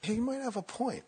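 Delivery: high, dawning realisation in mock voice; won over by doubt. Meditator starting to believe the mind's complaints, mock dawning conviction. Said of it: "you go".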